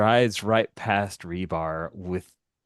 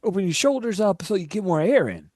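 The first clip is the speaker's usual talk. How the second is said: higher, louder coaching voice; urgent, friendly correction at raised pitch; eager running coach; 12 semitones higher, 8 dB louder, faster than usual. Acted. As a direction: call out corrections to a runner, pitched up. Playing Marcos coaching Sammy while running.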